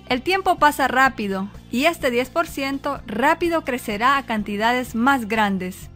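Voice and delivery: normal talking voice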